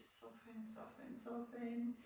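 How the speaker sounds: hushed, drawn-out softens fading into a near-whisper; soft soothing cue. Shown as a demonstration. Yoga teacher easing a class into relaxation, hushed. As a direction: let each soften melt away.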